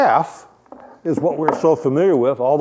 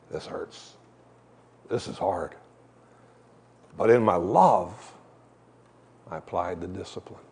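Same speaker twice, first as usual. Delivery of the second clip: much softer, a near-whispered confession of pain; hushed and heavy, remembering a hard duty; sad; 7 semitones lower, 8 dB quieter than usual. Retold or imitated. Father's painful words recalled.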